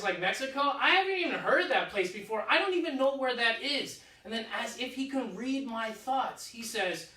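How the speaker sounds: ordinary delivery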